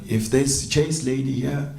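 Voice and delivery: ordinary delivery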